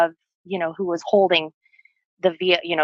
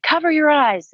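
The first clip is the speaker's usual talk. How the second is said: higher, urgent quoted warning; retold urgent warning; 8 semitones higher, 3 dB louder than usual. Boyfriend warning her before kicking the window, retold urgently.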